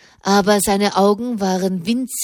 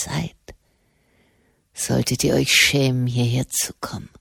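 bitter prisoner, dazed; 5 semitones lower than usual. A narrator's reading. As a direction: lower and hushed, as if dreaming. Prisoner voiced low.

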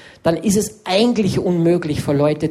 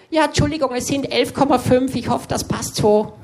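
higher, apologetic shopkeeper voice; mimicked shop assistant; 7 semitones higher than usual. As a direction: play the fussy deli clerk, sorry about the weight. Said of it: "und dann sagen die". (Verkäuferin an der Wursttheke, entschuldigend und höher)

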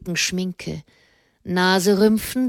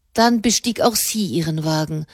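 usual speaking voice

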